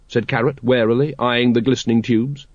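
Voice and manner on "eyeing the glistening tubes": plain reading voice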